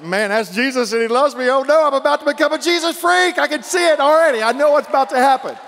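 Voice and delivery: high, loud and excited; young convert shouting with delight. Retold or imitated. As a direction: burst out with joy at finding Jesus. Retelling his own conversion.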